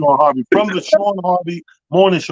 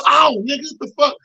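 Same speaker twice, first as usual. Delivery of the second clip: high-pitched mock startled yelp; jumpy pained surprise; 12 semitones higher than usual. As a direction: play the guy who just got stuck with a pin. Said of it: "They go".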